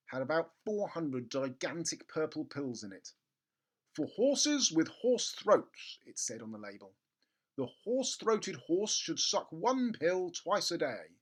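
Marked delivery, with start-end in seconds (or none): 3.9-6.0 high, slow official label voice; label read. Acted. Reading the label aloud. 7.6-11.2 high, slow official label voice; label read. Acted. Reading the label aloud.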